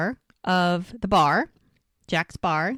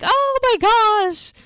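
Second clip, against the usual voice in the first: thrilled squeal; 4 dB louder than usual. Retold or imitated. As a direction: imitate a whole table squealing at happy news. Everybody reacts to pregnancy news.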